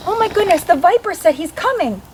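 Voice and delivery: put-on high, panicky child voice; scared little girl. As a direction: squeal it like a frightened kid. Scared girl in ghost story.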